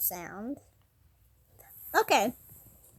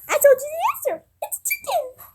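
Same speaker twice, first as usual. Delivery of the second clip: high, loud squealed reveal; excited squeal; 12 semitones higher, 7 dB louder than usual. Acted. Giving away the answer to the guessers, high excited squeal. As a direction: squeal it out, pitch shooting right up. Gleefully blurting out the quiz answer.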